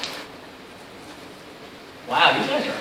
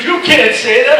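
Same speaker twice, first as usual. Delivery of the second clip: retold objection, higher and louder; indignant protest; 7 semitones higher, 8 dB louder than usual. Controller objecting to the pilot's name-calling on frequency, indignant. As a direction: imitate a controller loudly protesting.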